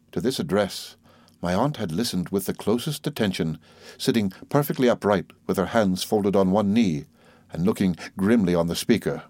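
plain reading voice

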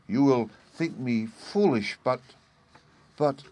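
sheepish, unsure appeal; hesitant confession. A narrator's reading. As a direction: falter with long pauses before going on. Perplexed wife hesitates before husband.

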